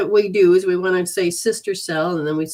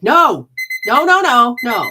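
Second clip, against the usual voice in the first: mock protest; 5 semitones higher, 10 dB louder than usual. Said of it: "You're like".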